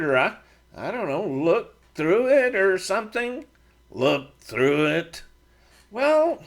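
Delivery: nudging Brent to try the charm; Matt, eager. A narrator's reading. Matt urges about the charm.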